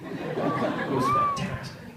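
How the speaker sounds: high drawn-out delight. Real, not acted. Recalling riding Fred in the desert, high delighted drawl. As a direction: savour the word fantastic.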